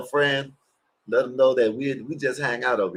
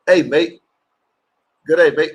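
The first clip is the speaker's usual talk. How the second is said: mock Australian accent; 5 semitones higher, 7 dB louder than usual. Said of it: "I don't even know how they talk".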